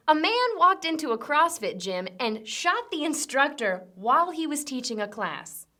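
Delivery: usual speaking voice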